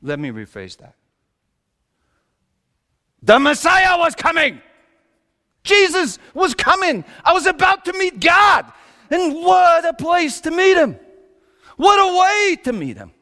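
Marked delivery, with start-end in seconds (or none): 3.2-13.1 high, loud panicked cries; frantic shouting. Acted. Panicked birth of the Messiah.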